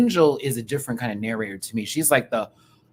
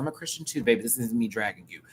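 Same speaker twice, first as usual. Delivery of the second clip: ordinary talk, nothing marked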